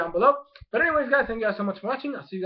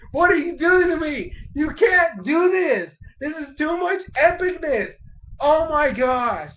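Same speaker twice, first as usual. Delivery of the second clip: overwhelmed fan cry; 7 semitones higher, 5 dB louder than usual. Real, not acted. Fan reacting to an epic Pokemon fight, excited wail. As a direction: cry out at the show as if it's too much.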